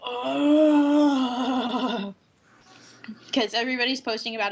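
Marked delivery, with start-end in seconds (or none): 0.1-2.1 long wobbling whiny groan; drawn-out mock wail. Gamer reacting to a game not released here, mock wail. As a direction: groan it out loud, quavering as it fades. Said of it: "And I'm just like".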